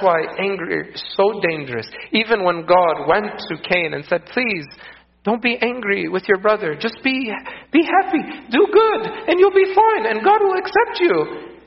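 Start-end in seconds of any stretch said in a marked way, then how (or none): 4.3-11.6 high, gentle coaxing voice rising; God pleading. Acted. God urging Cain not to be angry, high coaxing plea.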